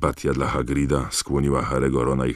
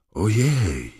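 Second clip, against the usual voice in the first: high-pitched startled squeal; narrator's restrained shriek; mild mock shriek; 7 semitones higher than usual. A narrator's reading. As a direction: squeal it higher, without real volume. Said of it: "wrzasnęła Lavender Brown".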